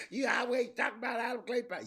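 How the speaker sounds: high, loud shouted complaint; mock shout of a heckler. Quoting a critic in the crowd with a high loud shout. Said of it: "somebody always tell me".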